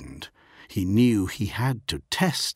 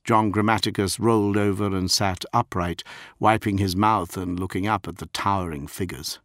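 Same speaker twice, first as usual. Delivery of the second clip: usual speaking voice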